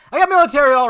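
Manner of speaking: high, loud and quick; sudden loud burst. Real, not acted. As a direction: blurt it out high and fast. Playful burst after sober remarks.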